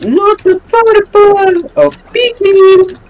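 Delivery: high hammed-up wail; mock wail.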